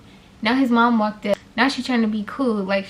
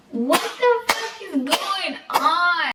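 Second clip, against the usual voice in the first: slow, higher taunting imitation of Emma; imitating Emma; 6 semitones higher, much slower than usual. Emma walking up clapping in her face, taunting. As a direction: mimic her confronting in your face. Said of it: "That's what she did in my face".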